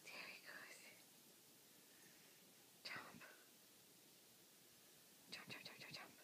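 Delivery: whispering. Real, not acted. Whispering to the puppy.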